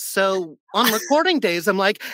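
ordinary talk, nothing marked

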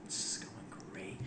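sarcastic whisper under the breath. Real, not acted. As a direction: breathe it out as a dry aside. Sarcastic aside.

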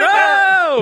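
high, loud wrestler entrance cry; entrance shout. Retold or imitated. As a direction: burst out like entering the ring. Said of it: "And I come out like".

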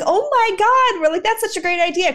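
high, gushing retold amazement; imitated burst of high excitement; excited fans. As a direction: gush it like a thrilled concertgoer. Retelling fans' delighted reaction.

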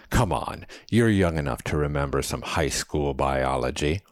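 ordinary delivery

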